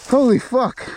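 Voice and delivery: high loud shocked curse. Real, not acted. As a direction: blurt it in shock. Scary descent, loud.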